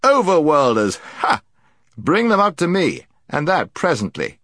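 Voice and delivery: loud, high imperious call; commanding shout. A narrator's reading. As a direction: order them up from above. Prince's voice calling down.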